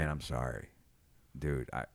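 very low, soft, sincere sorry; serious apology. Shown as a demonstration. Comic showing his co-host his serious apology, low and quiet. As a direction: apologise gravely, almost under your breath. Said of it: "What's your serious apology sound like?".

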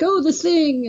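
put-on eager kid. Kid telling Jack Kirby his favorite character, eager.

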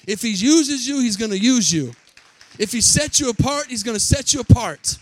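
loud, rising preacher's cry; preaching at full force. Acted. Preacher rousing the church at full force. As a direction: drive it home to the congregation.

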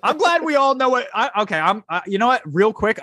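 high, eager jump in; excited cut-in. Real, not acted. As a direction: grab the floor in a rush. Excited cutting in.

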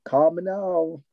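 put-on psychic hotline catchphrase in Jamaican accent; lilting Jamaican accent TV psychic; Miss Cleo. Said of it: "I would have done Miss Cleo".

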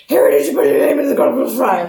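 high, rushed mock yell; frantic movie-meme outburst; Dumbledore yelling. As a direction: fire it off fast, high and garbled. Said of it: "as he's yelling at him".

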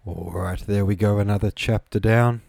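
ordinary delivery

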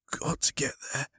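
urgent, breath-only whisper of an injured man; weak whisper. Acted. Injured man to his rescuer, whispering. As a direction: whisper it with little strength left. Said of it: "whispered the man".

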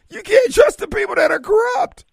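high, loud exasperated yell; a real burst of outrage, high and loud; outraged shout. Real, not acted. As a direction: shout it out in disbelief. Heated rant about untrustworthy politicians.

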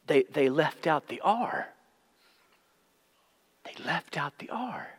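halting, hushed lament, dropping to a whisper; stricken old abbot. Acted. Grieving abbot to the younger monk about the copy, stricken. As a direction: let it fade to a whisper, with long pauses.

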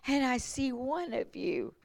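tearful joy. Retold or imitated. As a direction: imitate her crying with relief. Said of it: "she just started crying and crying".

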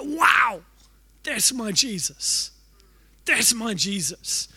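slow, hushed awe; breathless reverent awe; awed wonder. Shown as a demonstration. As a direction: savour each word in wonder. Awed exclamation, repeated slowly.

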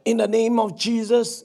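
ordinary talk, nothing marked

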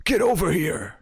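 high-pitched video game catchphrase call; Scorpion. Acted. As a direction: imitate a fighting-game character summoning an opponent. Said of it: "a bit of Scorpion".

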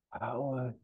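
faint, high last gasp of a name; soft and high, as if passing away; dying rasp. Acted. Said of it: "Bill is rasping and he passes away with a final".

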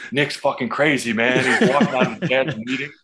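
excited retelling. Retold or imitated. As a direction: hype him up to everyone.

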